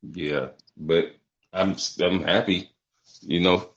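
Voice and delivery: ordinary talk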